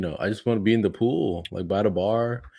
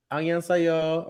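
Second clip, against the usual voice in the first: higher, lightly voiced little girl speaking Korean; daughter greeting in Korean; 9 semitones higher, much faster than usual. Daughter suddenly greeting dad in Korean, cheerful. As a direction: chirp it like a small girl. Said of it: "my daughter will turn around and go".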